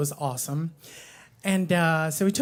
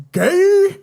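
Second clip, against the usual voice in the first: [name]; 12 semitones higher, 10 dB louder than usual. Retold or imitated. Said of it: "You know how [name] says that".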